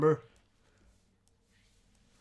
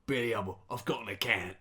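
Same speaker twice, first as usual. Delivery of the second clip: Cockney. Shown as a demonstration. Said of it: "your Cockney".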